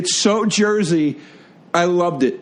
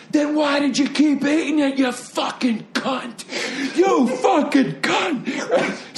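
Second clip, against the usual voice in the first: high-pitched mock scream, last words dragged out; screaming Jersey woman; 10 semitones higher, slower than usual. Drunk wife yelling at the complaining lady, mock high scream. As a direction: imitate a Jersey woman screeching abuse. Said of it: "Screaming it at her".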